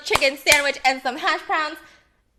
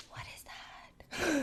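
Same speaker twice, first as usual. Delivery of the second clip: whispering; 13 dB quieter, much slower than usual. Real, not acted. Whispering over food.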